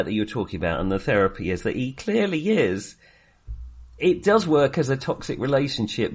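ordinary talk

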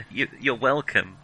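ordinary talk

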